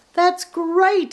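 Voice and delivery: bright, high, loud happy exclamation; smiling delight. A narrator's reading. Easter Bunny greeting Santa's news with a smile. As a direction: beam as you say it. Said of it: "smiled Easter Bunny".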